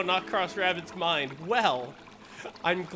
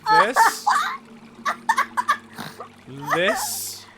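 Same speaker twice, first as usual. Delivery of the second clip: squeaky voice, much higher; silly face voice; 7 dB louder, slower than usual. Pretend. Showing a friend the funny face, high squeaky voice. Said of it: "That's your face right now".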